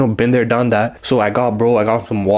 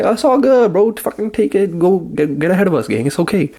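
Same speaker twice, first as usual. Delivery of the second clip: much higher, sugary mock politeness; mock sweet; 7 semitones higher than usual. Storyteller answering the annoyed woman in line, mock sweet and high. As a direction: sarcastically wave her ahead in a high sweet voice. Sarcastic reply to line cutter.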